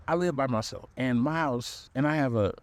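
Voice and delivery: usual speaking voice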